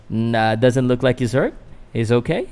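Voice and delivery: ordinary delivery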